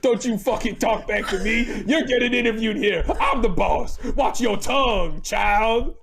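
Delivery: high, loud mock outrage; mock bossy scolding. Interviewer telling off the interviewee who objected to laughing, mock outrage. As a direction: tear into them as the affronted boss.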